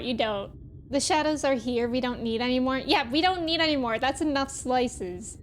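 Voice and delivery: ordinary talk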